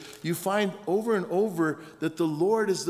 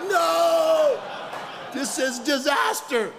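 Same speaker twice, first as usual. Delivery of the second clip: high, loud cry of dismay; hammed-up wail, stretched out in despair; horrified mock shout; 13 semitones higher, 5 dB louder, much slower than usual. Preacher retelling his open zipper disaster, mock horrified shout.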